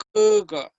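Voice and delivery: "kuga" with a loud high first syllable, dropping on the second; pronunciation model. Shown as a demonstration. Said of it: "¿Cuál estoy pronunciando acá?".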